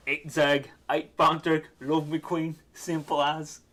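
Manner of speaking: loud, blunt Cockney goblin chant. Acted. Goblins sum up their ideology, loud hammed-up Cockney accent. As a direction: punch it out in broad mock Cockney. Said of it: "all the goblins speak in a Cockney accent".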